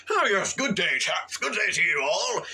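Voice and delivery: high, loud, hammed-up gentlemanly hello; mock gentleman greeting. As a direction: play at greeting the chaps grandly. Mock posh greeting riffing on chaps.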